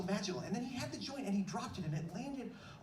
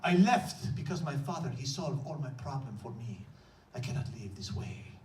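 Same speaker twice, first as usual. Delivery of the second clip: put-on low, slow Egyptian accent telling his story; deliberate Egyptian accent, much lower; 8 semitones lower, slower than usual. Bodega clerk Mohammed telling his story, low Egyptian accent. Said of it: "he says in a heavy accent".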